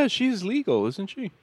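high-pitched mock creep; put-on creepy insinuating question. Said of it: "I said in a creepy voice".

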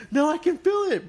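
high-pitched pleading objection; retold alarmed protest. Patient stopping the doctor about to cut, alarmed protest. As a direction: protest urgently, voice jumping up high.

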